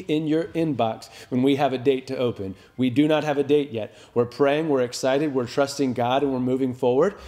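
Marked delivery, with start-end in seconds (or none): none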